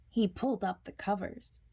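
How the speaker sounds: ordinary delivery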